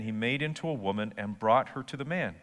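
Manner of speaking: ordinary delivery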